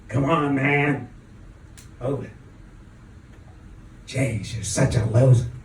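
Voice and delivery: mildly gruff, barked demand. Retold or imitated. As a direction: bark it like an impatient boor. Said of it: "in a totally uninspiring and gruff voice".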